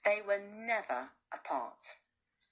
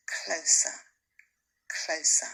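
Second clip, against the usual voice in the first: hushed suspense; 3 semitones lower than usual. A narrator's reading. Narrator whispers as the hyenas close in. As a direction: breathe it like the danger is creeping in.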